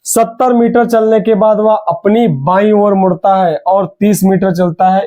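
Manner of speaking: ordinary talk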